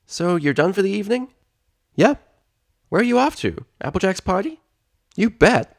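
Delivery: cheerful dialogue. Acted. Spike and Twilight dialogue.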